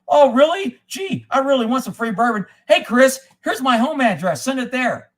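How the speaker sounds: loud, high mock-eager sarcasm; mocking a sneaky request; sarcastic mock. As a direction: pretend to fall for the trick. Mocking sarcastic reply to viewer.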